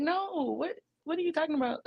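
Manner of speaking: lightly hammed-up baffled reply; mock disbelief. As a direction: brush off his sulk in disbelief. Woman answering the hurt guy.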